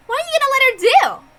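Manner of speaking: high-pitched worried outburst; alarmed cry. Acted. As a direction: panic at what she's allowing. Alarmed at the witch's concession.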